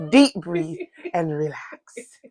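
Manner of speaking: slow, soothing "Deep breathe and relax"; slow soothing breathing cue; calming demo. Shown as a demonstration. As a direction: draw it out like guiding a breath. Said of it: "you have to take your time".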